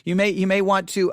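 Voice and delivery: normal talking voice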